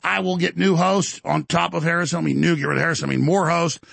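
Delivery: usual speaking voice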